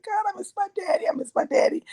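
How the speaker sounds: high, tearful plea; a child's teary longing; crying out for her late daddy; retold crying. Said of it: "I was like".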